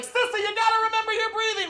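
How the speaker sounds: put-on very high-pitched little-kid voice; bossy little sister. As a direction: mimic a child playing birth coach. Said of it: "she's like".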